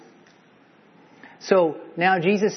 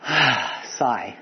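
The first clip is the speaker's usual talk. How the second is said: heavy sigh. Retold or imitated. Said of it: "heavy sigh from Jesus".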